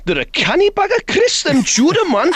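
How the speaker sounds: very high, comic Geordie impression; exaggerated Tyneside voice, pitched right up; Tyneside accent. Shown as a demonstration. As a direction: squeal it out in broad Geordie. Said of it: "if we were up in Tyneside, what would happen there?".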